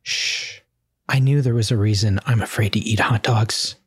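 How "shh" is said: whispered shh; hushing. Acted. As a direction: hush her while hiding. Hiding from villain.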